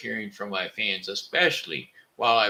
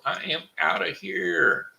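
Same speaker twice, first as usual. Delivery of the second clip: catchphrase; 9 semitones higher than usual. Real, not acted. Catchphrase sign-off.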